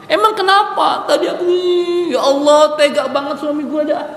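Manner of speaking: a worried mother gasping at the news; fussing mother. Retold or imitated. Mother asks crying child, upset.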